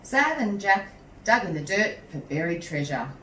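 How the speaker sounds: ordinary delivery, nothing marked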